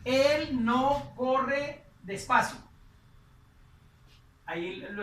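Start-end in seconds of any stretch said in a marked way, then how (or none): 0.0-2.6 slow, louder model phrase; slow translation. Shown as a demonstration. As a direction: read it out slowly for learners. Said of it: "Ahí estaríamos diciendo".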